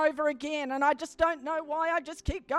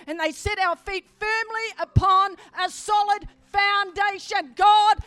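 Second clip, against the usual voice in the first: loud, raised, emphatic sermon delivery; full-force preaching; 4 semitones higher, 6 dB louder than usual. Real, not acted. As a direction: build and proclaim it to the whole church.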